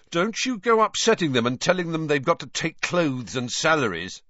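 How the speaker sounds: warning. A narrator's reading. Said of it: "said Fred warningly".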